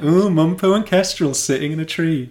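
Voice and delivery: high, loud sing-song schoolyard jeer; mock the kids like a bullying teacher; playground taunt. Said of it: "He's like".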